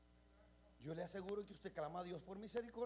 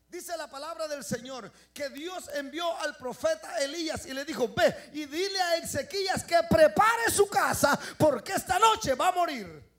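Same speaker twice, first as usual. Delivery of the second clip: loud, higher proclamation of God's word; shouted preaching; 7 semitones higher, 19 dB louder than usual. Acted. Preacher shouting God's command to Hezekiah, full force. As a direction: thunder out the prophet's message.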